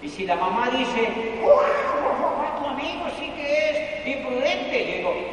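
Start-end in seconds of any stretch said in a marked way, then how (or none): 1.4-4.9 high, scandalised mother's voice; mock shocked mother. The friend's mother remarking on the rude guest, mock shocked. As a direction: play the startled mom, slowly.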